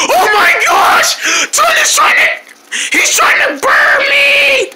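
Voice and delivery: high, drawn-out scream; terrified. Acted. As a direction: shriek in hammed-up panic. Fleeing the monster.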